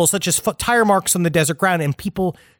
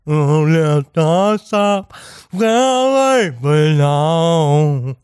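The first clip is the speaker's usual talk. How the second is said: warbling mock singer voice; 3 semitones lower, much slower than usual. Voicing captive man singing.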